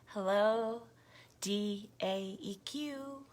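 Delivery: normal talking voice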